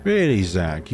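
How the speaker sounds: ordinary talk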